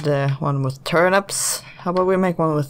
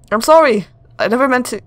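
higher, louder pleading sorry; earnest apology; 6 semitones higher, 5 dB louder, faster than usual. Shown as a demonstration. Girl pleads with the ghost, pleading and sincere. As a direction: beg forgiveness, really meaning it. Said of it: "That wasn't very emotional".

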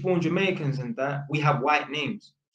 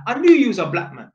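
louder, higher teasing gotcha; gleeful reveal; 7 semitones higher, 7 dB louder than usual. Retold or imitated. Tenant revealing he guessed it, loud high gotcha. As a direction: say it like you caught him out.

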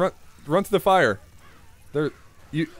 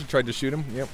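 ordinary talk

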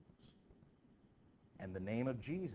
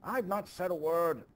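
high, loud indignant retort; indignant protest; 9 semitones higher, 8 dB louder than usual. Acted. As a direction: protest you said nothing, outraged. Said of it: "Giles Corey, please be quiet".

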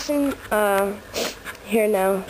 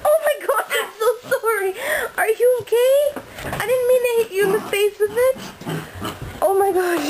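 alarmed apology; 9 semitones higher than usual. Real, not acted. Owner apologizing to puppy she hit, panicked and near tears. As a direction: gasp out a panicked sorry, voice cracking.

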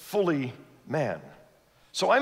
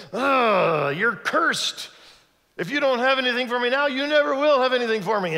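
high, loud, fast irritated outburst at the fig tree; cursing in a mood; 8 semitones higher, 5 dB louder than usual. Acted. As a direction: snap at the tree, rising higher and louder to the end. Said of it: "he woke up in a bit of a mood".

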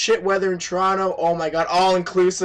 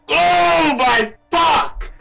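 slow, loud disbelieving shout; stunned shout; 8 semitones higher, 5 dB louder, much slower than usual. Real, not acted. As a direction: stretch it out in shock. Stunned at pull, drawn out.

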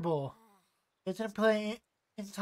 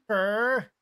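a louder playful growl; mock growl; 6 dB louder than usual. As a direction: growl like a sulking rival. Voicing a character's frustration.

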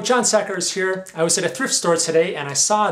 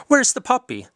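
ordinary talk, nothing marked

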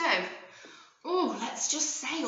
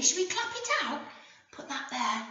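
ordinary talk, nothing marked

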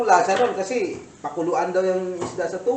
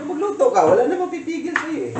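high, louder mock reply after a laugh; high-pitched teasing retort; 10 semitones higher, 4 dB louder than usual. Repeats retold reply after laughing. (matinis na pabirong sagot)

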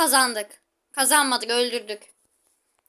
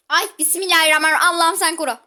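panicky prayer; 4 semitones higher, 8 dB louder, much faster than usual. Real, not acted. Panicked prayer during fight.

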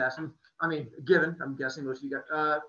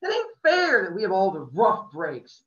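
loud, higher, pleading outburst; passionate; 5 semitones higher, 11 dB louder than usual. A narrator's reading. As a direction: pour out the unfairness. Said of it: "I cried passionately".

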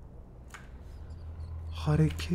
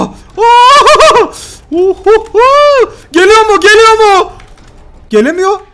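high, loud half-laughing panic; frightened laughing yells; 12 semitones higher, 11 dB louder, much slower than usual. Real, not acted. Gamer dodging the monster, yelling high with nervous laughter.

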